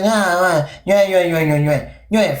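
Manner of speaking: mock the critics with droning nonsense babble; mocking imitation. Streamer mimicking his complaining followers, mocking babble. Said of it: "And you guys are like".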